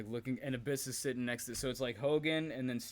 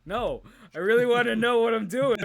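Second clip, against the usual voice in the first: much higher, much louder whiny voice; mock whiny plea; 11 semitones higher, 14 dB louder than usual. Wrestler pleading with agent for instructions, high whiny. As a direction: whine it like an eager rookie.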